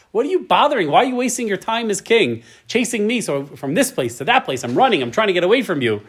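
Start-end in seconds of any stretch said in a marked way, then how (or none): 0.1-3.2 much higher, pleading David; lightly voiced pleading David. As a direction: plead with the king to stop. Voicing David pleading with Saul.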